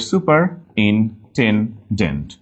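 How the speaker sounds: slow "superintendent": super, in, ten, dent; broken into syllables. Shown as a demonstration. Teacher making spelling clear, "superintendent" slowly in pieces. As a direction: pause between each piece of "superintendent". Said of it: "ভেঙে নিলে clear হয়ে যাবে".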